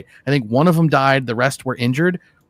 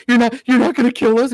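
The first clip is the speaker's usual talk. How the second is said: high, trembling mock question; hammed-up frightened tourist; mock scared plea; 8 semitones higher, 4 dB louder than usual. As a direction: imitate the terrified tourist asking. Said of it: "he's like".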